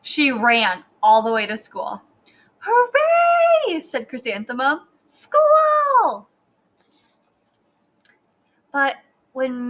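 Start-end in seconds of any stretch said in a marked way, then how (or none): 2.6-3.8 high, loud, drawn-out cheer; shrill-pitched gleeful holler; excited yell. A narrator's reading. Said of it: "and she yelled". 5.1-6.3 high, loud, long-held cheer; big high-pitched holler; excited yell. A narrator's reading. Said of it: "and she yelled".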